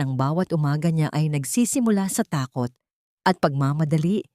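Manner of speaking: ordinary delivery, nothing marked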